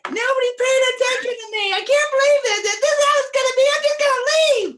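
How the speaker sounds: high, loud whining rant; whiny mock tantrum. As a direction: squeal it out like a sulking kid. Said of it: "you came like".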